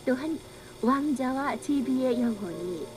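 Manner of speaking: ordinary delivery, nothing marked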